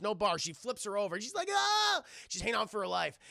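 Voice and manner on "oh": high, loud cry of alarm; mock scream. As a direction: yelp as if tipped over a ledge. Imitates woman flipped over edge.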